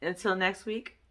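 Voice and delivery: usual speaking voice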